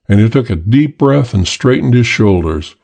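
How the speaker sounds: usual speaking voice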